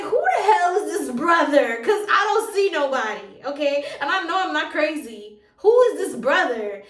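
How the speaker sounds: loud, high outburst; worked-up, loud retold demand; exasperated blurting. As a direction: blurt it out in full-voiced disbelief. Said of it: "So I just finally blurted out".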